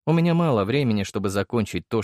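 ordinary delivery, nothing marked

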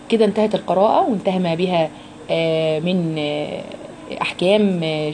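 ordinary talk

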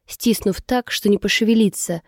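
ordinary delivery, nothing marked

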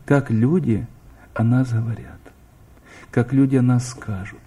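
ordinary delivery

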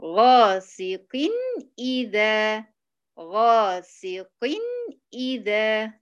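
غاسق drawn out slowly before إذا; slow tajweed recitation; Quranic recitation demo. Shown as a demonstration. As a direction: stretch غاسق then clear إذا. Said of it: "المثال للتنوين بالكسر".

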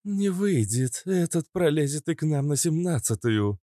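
high-pitched female character; high, put-on woman's voice. A narrator's reading. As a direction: lift the pitch well up for the woman's line. Narrator voices Cobra, a woman.

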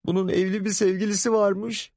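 very high, appalled reveal; mother telling father of the affair; scandalized mother. Acted. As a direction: spill the scandal.

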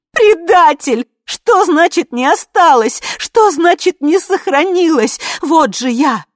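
very high, loud yelling; high-pitched, full-voiced raging; screamed tirade. A narrator's reading. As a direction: scream in betrayed fury. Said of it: "орала Ви".

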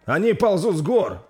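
high, loud warning shout. Acted. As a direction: yell it down the line. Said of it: "прокричал Викар".

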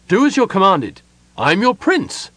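high, forceful order; commanding cry. A narrator's reading. Prince orders the staring elves to obey, crying out. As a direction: pull rank with a cry. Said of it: "Sylvan cried".

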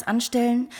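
normal talking voice

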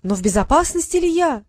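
put-on falsetto girl voice. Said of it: "срывающимся фальцетом".

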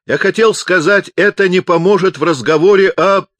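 high, loud, breaking off unfinished; desperate loud explanation. A narrator's reading. Pupser explains into the megaphone, loud and desperate. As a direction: strain to get the point across.

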